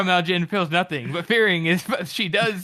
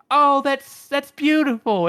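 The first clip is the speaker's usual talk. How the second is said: high, slow, louder mock swooning; mock gushing; 8 semitones higher, 5 dB louder, slower than usual. Host parodies a reader finding the scene romantic, gushing. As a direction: gush as if at a sappy romance.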